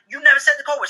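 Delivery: loud, high-pitched protest; acted husband's exasperated retort; arguing back. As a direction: deny it with rising indignation.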